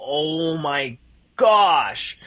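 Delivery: very slow, drawn-out amazement; retold jaw-drop, long and lingering; awestruck. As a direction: stretch each word in stunned awe. Awed reply to invitation, retold.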